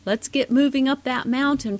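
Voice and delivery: rally them up the mountain; rallying cry. Acted. Parents rallying the kids.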